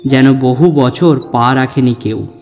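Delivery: ordinary delivery, nothing marked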